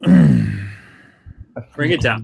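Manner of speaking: slow deep demo voice; low voice. Shown as a demonstration. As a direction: drop it way down, slowly. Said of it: "if you do it in your voice".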